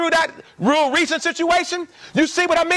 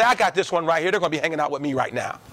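low, quick, sly aside; low, hurried aside like grabbing a kid; mock kidnapper aside; 9 semitones lower, 4 dB quieter, much faster than usual.

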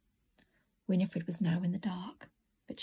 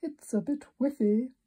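character voice; 4 semitones higher than usual. A narrator's reading. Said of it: "said Mister Burley".

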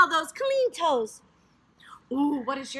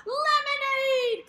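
delighted gasp; 8 semitones higher, slower than usual. Acted. Excited echo of child's answer.

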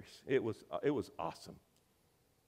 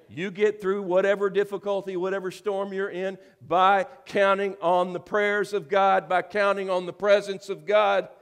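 raised, loud pulpit proclamation; full-force preaching; 8 semitones higher, 10 dB louder than usual. Real, not acted. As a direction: drive it home to the congregation, loud and high.

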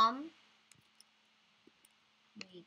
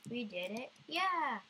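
normal talking voice

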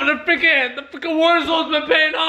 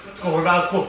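ordinary delivery

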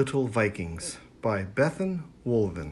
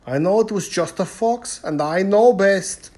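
louder, blustering chief; dismissive chieftain; 7 semitones higher, 8 dB louder than usual. A narrator's reading. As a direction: wave it away like the proud chief. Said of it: "said the chieftain".

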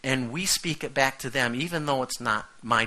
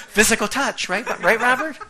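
higher, louder teasing through laughter; laughing tease; 11 semitones higher, 11 dB louder than usual. Real, not acted. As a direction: needle Robert while still laughing.

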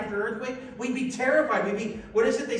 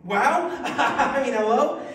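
loud laughing wow; delighted shout of wow with a laugh; excited wow; 2 semitones higher, 5 dB louder, slower than usual. Real, not acted. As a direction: burst out with joy. Preacher laughing at Jesus reigning.

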